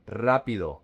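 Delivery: RA stressed in "rápido", rest falling away; stress on first syllable. Shown as a demonstration. Teacher models rápido for learners, stress on RA. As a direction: punch the opening "rá" of "rápido". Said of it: "The emphasis is on the R A".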